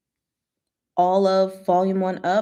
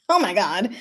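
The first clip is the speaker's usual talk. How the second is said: plain reading voice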